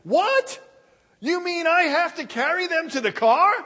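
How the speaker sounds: very high, loud outraged squeal; a teen's disbelief at having to carry them; shocked teen. Acted. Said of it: "he heard his son behind him say".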